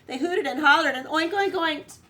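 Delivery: high, loud lively narration; rowdy barnyard telling; excited build. A narrator's reading. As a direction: let the noise build up. Pigs going wild.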